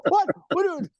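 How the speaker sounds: high-pitched indignant sputter, imitated; mock outrage. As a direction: act out executives going nuts. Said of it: "they were so fucking pissed off".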